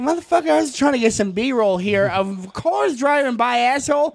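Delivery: high furious rant at the guy; angry rant. Retold or imitated. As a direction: tear into him over his stupid car. Angry cameraman voiced in story.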